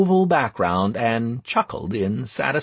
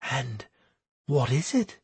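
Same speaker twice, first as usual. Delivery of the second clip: hushed whisper; 3 semitones higher, 5 dB quieter than usual. A narrator's reading. Bewildered priestess asks about the plan, in a quiet whisper. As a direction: drop to a tiny hush, voice lifted. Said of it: "Beth's whisper became even quieter".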